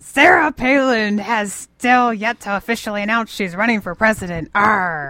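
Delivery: hammed-up pirate reading the news; mock pirate with a rolling growl. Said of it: "read the K S L Kickstart in pirate voice".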